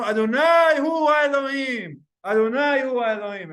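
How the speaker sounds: the people calling out after the fire; crowd proclamation. Retold or imitated. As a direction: declare it to the heavens.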